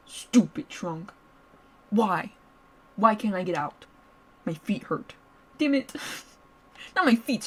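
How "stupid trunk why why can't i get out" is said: slow, voiced, sulky complaint; sulky grumble. Captive grumbling aloud at the locked trunk, sulky.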